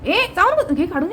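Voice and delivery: high-pitched warning to a pet; high, urgent pet-owner command; scolding a pet. Real, not acted. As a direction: tell the pet off sharply up high. Telling the pet not to chew.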